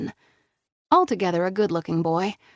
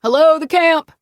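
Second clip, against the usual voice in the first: loud, high call across the dark; yelling; 10 semitones higher, 11 dB louder than usual. A narrator's reading. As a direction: call out from far away. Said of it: "a deep voice yelled".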